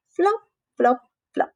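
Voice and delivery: high bouncy flop sounds; hopping sounds. Acted. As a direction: hop each flop like a frog. Saltitos de rana. (saltitos de rana, agudos)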